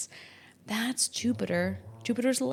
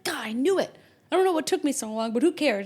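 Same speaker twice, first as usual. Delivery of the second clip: thrilled; 5 semitones higher, 5 dB louder, faster than usual. Retold or imitated. Thrilled at going viral.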